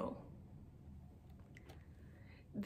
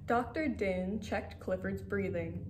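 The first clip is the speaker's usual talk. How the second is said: usual speaking voice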